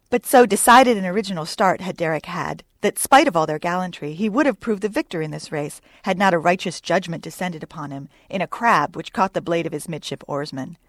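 ordinary delivery, nothing marked

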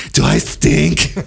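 slow, drawn-out mock wife voice; imitating wife. Wife greets husband at home, imitated slow needy question. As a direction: stretch the question out, mimicking her.

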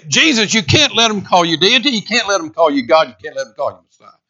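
indignant protest. Acted. Objectors to Jesus over the crowd's praise, indignant protest. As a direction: play a worked-up objector pleading his case.

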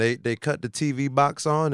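ordinary delivery

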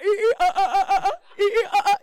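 loud, high laughing monkey hoots; mock monkey noises. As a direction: hoot like a monkey, cracking up.

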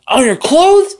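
very high, disbelieving retold question; shocked grandma. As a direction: imitate an aghast grandmother, voice leaping upward.

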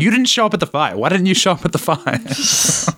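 high-pitched pestering complaint; mock indignation. Philosopher taunting a rival over a missed fight, mock indignant.

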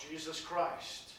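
ordinary talk, nothing marked

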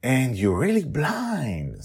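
high, loud, bubbly hostess voice; eager hostess Sandra. Acted. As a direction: fuss over guests, voice up high. Sandra realizes.

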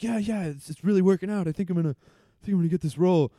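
high, loud, over-eager wannabe; deluded hopeful actor. Acted. Struggling L A actor telling friends, hammed-up optimism. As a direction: insist brightly that the career is taking off. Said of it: "disillusioned L A story".

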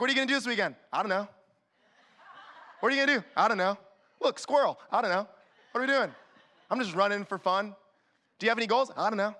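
goofy aimless runner. Pretend. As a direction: play a scatterbrained friend. Goofy dialogue, high questions.

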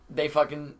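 normal talking voice

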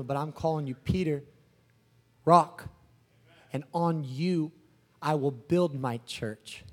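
low, quiet, solemn pronouncement; calm Jesus. Acted. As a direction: bestow a new name softly and deliberately. Plays Jesus naming Peter.

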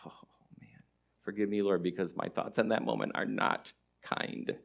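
low, hushed confession under the breath; quiet, deep, exasperated aside; muttered aside. Acted. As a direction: mutter a weary little prayer to yourself.

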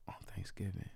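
whispered aside; a pointed whisper. Real, not acted. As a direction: breathe it out under your breath. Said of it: "whispered aside".

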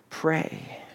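a single soft whispered word. Shown as a demonstration. Preacher ending his list of prayer lines, whispered. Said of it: "That's how he says to them".

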